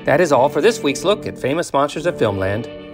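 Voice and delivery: normal talking voice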